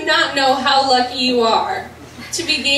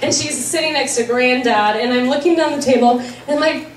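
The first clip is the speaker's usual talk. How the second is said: normal talking voice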